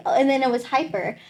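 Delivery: ordinary delivery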